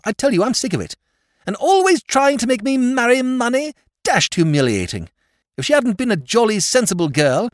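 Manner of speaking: high, loud raging complaint; furious tirade. Acted. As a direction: let all the anger out. Nephew continuing his shouted tirade.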